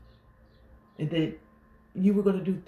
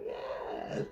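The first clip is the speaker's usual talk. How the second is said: whispered, savored "yes"; very soft whispered approval; private delight; 10 dB quieter than usual. Acted. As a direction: breathe it out to yourself, hushed. Quiet private reaction to favorite song.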